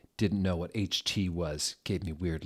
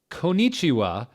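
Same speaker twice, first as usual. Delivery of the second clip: a lightly higher, louder teacher's greeting; mock Japanese greeting; 10 semitones higher, 9 dB louder than usual. The goth teacher welcoming her class, in a lightly hammed-up greeting. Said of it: "said Professor Sinister in Japanese".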